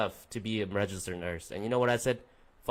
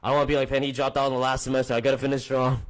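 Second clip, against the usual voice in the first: determined self-talk; 4 semitones higher, 10 dB louder, faster than usual. Pretend. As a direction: pretend pep talk, much louder and higher. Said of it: "just think about it".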